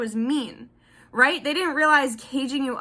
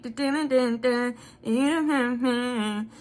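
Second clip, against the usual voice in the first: dramatic dun dun dun; much slower than usual. Pretend. Dramatic sting sound.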